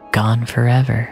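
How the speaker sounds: usual speaking voice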